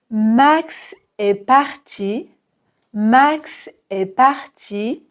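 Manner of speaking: Max with a wide open A, slowly; clear A in Max. Shown as a demonstration. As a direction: stretch the A in Max, not an E. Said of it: "Prononcez bien le A".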